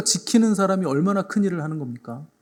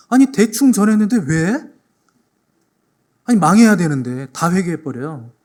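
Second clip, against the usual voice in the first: exasperated outburst; 3 semitones higher, 5 dB louder than usual. Retold or imitated. Jonah fuming that his lazy warning still worked, exasperated. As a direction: voice a prophet fuming at the mercy. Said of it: "요나가 그걸 보니까 막 속이 뒤틀리는 거예요".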